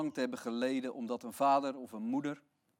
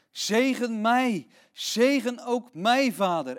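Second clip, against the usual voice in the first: louder, slow, higher pleading cry; desperate plea; 6 semitones higher, 7 dB louder, slower than usual. Acted. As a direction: beg on the edge of tears. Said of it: "En hij barstte in tranen uit".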